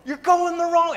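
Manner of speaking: high, slow, loud warning shout; mock roadside yell. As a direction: holler a warning across the road. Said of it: "just yelling at 'em".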